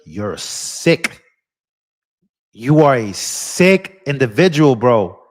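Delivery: ordinary delivery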